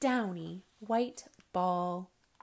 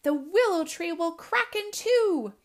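high, loud alarm; screeching warning; 7 semitones higher, 7 dB louder than usual. Acted. Mother owl warns of cracking tree, screeching. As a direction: warn urgently over a storm. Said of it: "screeched Mother Owl".

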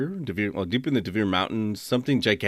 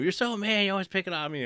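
mock whiny complaint; 10 semitones higher than usual. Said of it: "And she's like".

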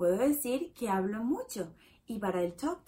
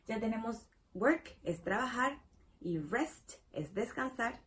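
normal talking voice

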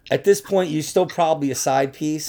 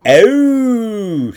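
high, loud drawn-out oh; imitating a Long Island groan; mock Long Island moan; 8 semitones higher, 7 dB louder than usual. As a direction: wail a long nasal oh. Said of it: "they probably heard you go".

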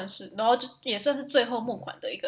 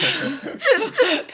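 laugh; 8 semitones higher, 8 dB louder than usual. Real, not acted. Laughing at the joke.

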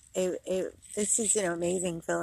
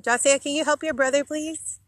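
calling out; 7 semitones higher, 5 dB louder than usual. Real, not acted. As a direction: call over to him, louder and higher. Calling to son across park.

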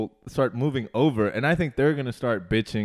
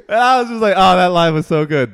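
laughing; 4 semitones higher, 10 dB louder than usual. Real, not acted. Reacting to funny line.

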